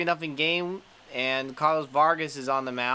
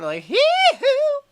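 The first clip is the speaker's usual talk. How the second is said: mock hee-hoo; 9 dB louder, slower than usual. Mimicking a weird pitch.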